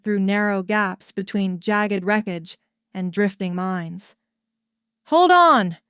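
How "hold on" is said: loud, high warning shout. A narrator's reading. Pilot warning crew of incoming metal, shouting. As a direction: yell it as debris flies in. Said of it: "he shouted".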